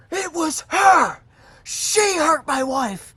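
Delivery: high, loud, slow mock yell of an angry husband; imitating angry old man. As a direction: ham up the old man's outraged accusation. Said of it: "he just proceeds to go".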